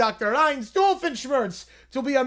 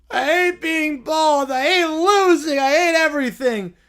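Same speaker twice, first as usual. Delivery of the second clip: Caillou wail; 3 semitones higher, 4 dB louder than usual. Acted. Caillou wailing.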